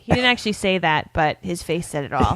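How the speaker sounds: ordinary talk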